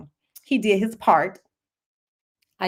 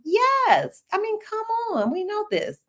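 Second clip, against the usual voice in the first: emphatic yes; 12 semitones higher than usual. Real, not acted.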